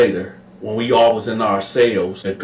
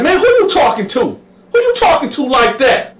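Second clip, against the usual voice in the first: very high, loud challenge; high loud menace; Sweet Lo threatening; 5 dB louder than usual. Retold or imitated. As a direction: step up menacingly. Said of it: "He was like".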